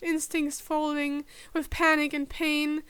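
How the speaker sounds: plain reading voice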